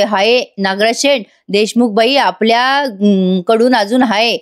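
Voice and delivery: ordinary delivery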